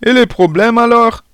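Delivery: very high, loud whiny schoolboy demand; displeased protest. A narrator's reading. Ignored pupil asking about the lesson, indignant and shrieking. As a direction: squawk an indignant question. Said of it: "qui n'avait pas l'air content".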